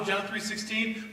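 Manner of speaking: normal talking voice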